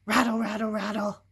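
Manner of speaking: loud rattles; rattle noises. Acted. Reader voicing the dog's rattle, loud rattles. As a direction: shake out each rattle.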